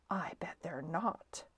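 soft, whispery cat retort; whispered taunt. Acted. Snowbell the cat contradicting Stuart's boast, whispered taunt. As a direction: doubt him in a whisper.

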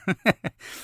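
short amused chuckle; brief laugh at own joke. Real, not acted. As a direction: laugh off the absurd speech. Laughs after mock parent speech.

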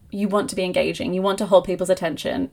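normal talking voice

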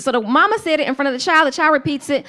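ordinary talk

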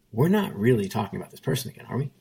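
ordinary delivery

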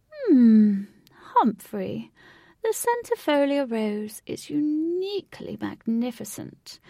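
put-on slow, concise genteel old lady; slow, precise old lady. A narrator's reading. Old lady admiring roses to her husband, slow and precise. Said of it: "One lady said slowly and concisely".

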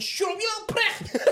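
high, loud yelled put-down. Acted. Man telling an eight-year-old off, shouting. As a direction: snap into an outraged yell. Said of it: "I started raising my voice".

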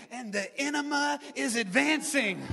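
"enema" said in place of enemy; slip of the tongue. Retold or imitated. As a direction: preach it confidently, with "enema" slipping out for enemy. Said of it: "And I said".

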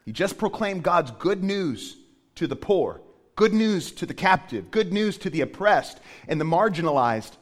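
rising emphatic sermon cadence; preaching. Acted. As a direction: build each good news line. Preaching builds to full force.

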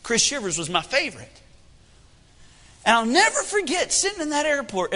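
ordinary talk, nothing marked

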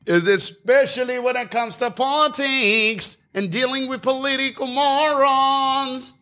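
very high, drawn-out comic rant; exaggerated outburst. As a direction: stretch the ends out in a high voice. Sudden performed outburst mid rant.

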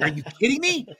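high, loud, disbelieving squeal; excited disbelief. Retold or imitated. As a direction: act out the thrilled disbelief. Retelling excited call with friend.

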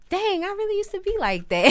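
high surprised exclamation about her son; amazed realization. Retold or imitated. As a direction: marvel at your own kid.

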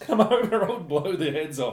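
loud, imitated Afrikaans-accented bravado; booming South Afrikaans accent, mimicking a threat. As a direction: call it out in a mock Afrikaner accent. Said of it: "in his South Afrikaans accent".

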